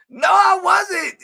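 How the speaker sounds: high, slow mock shout; mock indignant shout. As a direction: yell the denial in mock outrage. Mock quarrel with a viewer.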